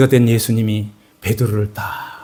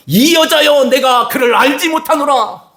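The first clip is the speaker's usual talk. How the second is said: angry denial; 12 semitones higher than usual. Acted. Peter angrily denying Jesus to the servant girl. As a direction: hurl the denial back at her. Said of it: "화가 나가지고 얘기하는 것 같아요".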